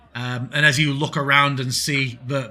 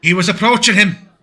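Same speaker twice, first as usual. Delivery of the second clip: higher, much louder public accusation; loud shout; 6 semitones higher, 11 dB louder than usual. Acted. Woman stepping into a tavern brawl, loud shout across all. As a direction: shout it loudly across the room. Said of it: "sort of shouts out loudly across all".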